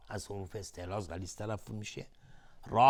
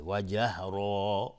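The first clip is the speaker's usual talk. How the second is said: جهرا slow, with the final aa drawn out; lengthened ending; much slower than usual. Shown as a demonstration. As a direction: stretch the last aa of جهرا for two beats. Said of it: "مشخص بشه که بعدش الفه".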